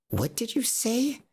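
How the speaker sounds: high, slow, incredulous challenge; imitating an affronted parent; mock offended mother.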